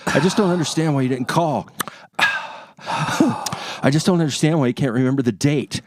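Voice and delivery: tut, exhale, then complain like a let-down wife; exasperated. Acted. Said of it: "the click of the tongue and the exhale".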